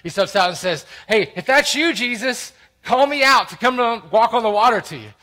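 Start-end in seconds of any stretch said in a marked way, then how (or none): 1.1-5.1 high, loud, cocky call-out; brash, pushy shout to a friend. Acted. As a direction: holler it boldly across the water. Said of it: "He's the guy that's brash".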